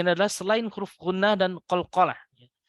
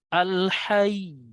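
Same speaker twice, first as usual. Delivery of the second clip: al-hayy raised on the doubled ya; rising stress; 4 semitones higher than usual. Shown as a demonstration. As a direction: lift the voice on al-hayy. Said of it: "Jadi agak naik".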